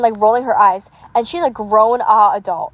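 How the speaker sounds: normal talking voice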